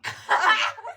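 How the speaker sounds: sudden high laugh; burst of laughter. Real, not acted. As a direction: crack up laughing. (ani kahkaha)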